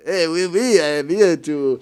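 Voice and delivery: hammed-up French accent, slow and drawn out at the end; a scammer's put-on French accent; mock French accent. Scammer posing as Brad Pitt messages her, mock French accent. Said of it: "the disgusting sounding French accent".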